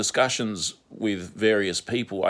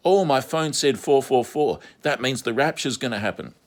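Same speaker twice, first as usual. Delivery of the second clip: excited woman voice; 6 semitones higher, 4 dB louder than usual. Retold or imitated. Mocking angel-number believers.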